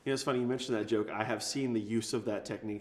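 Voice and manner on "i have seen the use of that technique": ordinary talk, nothing marked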